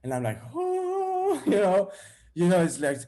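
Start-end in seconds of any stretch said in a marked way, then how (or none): 0.5-1.4 high sustained hoot; rising-heat sound. Retold or imitated. Jealousy heating his stomach, imitated boiling hoot. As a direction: imitate water coming to the boil. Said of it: "starting to get hot in my stomach".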